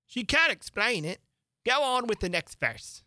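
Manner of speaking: griffin voice. Acted. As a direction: cut in like an impatient beast. Griffin high.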